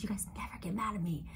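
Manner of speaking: hushed, quick whisper; whispered aside. Real, not acted. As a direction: whisper it quickly, like a secret to one group. Teasing confidential aside to Capricorns.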